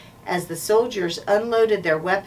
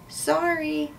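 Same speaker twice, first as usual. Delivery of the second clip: tiny, high-pitched apology; squeak; 8 semitones higher than usual. A narrator's reading. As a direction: squeak it out meekly. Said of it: "Jack squeaked".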